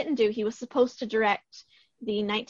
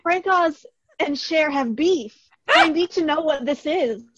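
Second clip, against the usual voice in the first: excited; 4 semitones higher, 5 dB louder than usual. Real, not acted. Excited gossip reveal.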